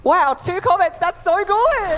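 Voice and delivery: sarcastic, high, laughing fake praise; high laughing sarcastic cheer; mock delight. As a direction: gush falsely over a tiny number. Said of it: "And I was just like".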